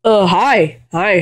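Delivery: ordinary talk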